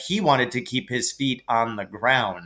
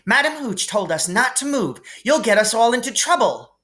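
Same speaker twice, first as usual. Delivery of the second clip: much higher, fast anxious scolding; bossy girl scolding; 10 semitones higher than usual. A narrator's reading. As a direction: warn him in a rush. Hermione scolding.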